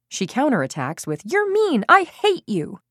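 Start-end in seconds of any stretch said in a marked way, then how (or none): 1.2-2.8 put-on high, loud furious outburst of a child; angry child. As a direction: hurl it back like a furious kid. Child counterattacks in a quarrel.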